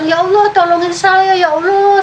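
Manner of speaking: high, frightened cry for help; a lost child screaming to God in the storm; retold scream. Little girl alone in storm cries to God, frightened high scream. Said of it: "saya menjerit-jerit lagi".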